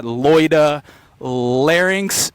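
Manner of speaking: "Loida Larynx" slowly, stretching each initial L; drawn-out L sounds. Real, not acted. Vlogger playing on his mom's name, "Loida Larynx" with drawn-out Ls. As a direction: linger on the L that opens "Loida" and "Larynx". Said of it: "trying to do a L L".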